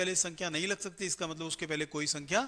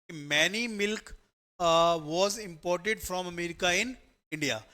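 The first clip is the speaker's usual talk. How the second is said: very slow, word by word reading of the question; 3 dB louder, much slower than usual. Shown as a demonstration. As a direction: read the sentence out slowly for the class to copy. Teacher reading out question sentence.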